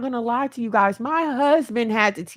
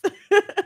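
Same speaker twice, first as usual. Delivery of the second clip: short embarrassed laugh through tears; teary laugh; 9 semitones higher, 4 dB louder than usual. Real, not acted. Mother near tears laughing at herself, embarrassed laugh. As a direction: laugh it off before crying.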